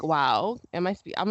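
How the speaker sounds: ordinary delivery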